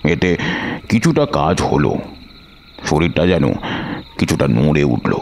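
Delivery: ordinary delivery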